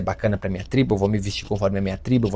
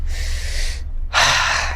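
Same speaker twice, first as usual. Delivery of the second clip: relieved sigh. Retold or imitated. Child comforted, relieved.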